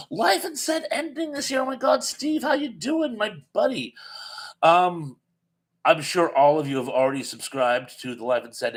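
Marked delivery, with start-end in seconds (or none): 0.1-3.9 high-pitched burst of delighted surprise; gasp, then gush in a high voice; excited greeting. Real, not acted. Host spotting Steve in the live chat, excited greeting.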